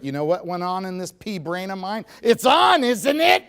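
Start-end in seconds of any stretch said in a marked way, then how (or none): 2.2-3.4 high, loud, laughing squeal; a husband realising his mistake, high and loud with a laugh; panicked realisation. Retold or imitated. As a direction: squeal it out in sudden alarm.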